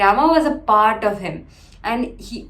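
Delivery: ordinary delivery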